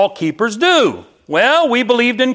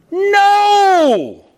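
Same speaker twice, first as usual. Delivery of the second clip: emphatic no; 11 semitones higher than usual. Acted. Preacher voicing Paul to the congregation, emphatic high no. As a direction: slam the door on the idea. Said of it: "And Paul is saying".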